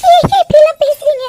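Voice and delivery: distressed plea. Acted. Wife pleading with husband, distressed. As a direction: protest through hurt.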